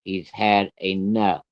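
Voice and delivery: very slow, word by word; measured, deliberate "He's had enough"; firm final warning. Retold or imitated. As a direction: say it slowly as a last warning. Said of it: "I'm gonna tell you one more time".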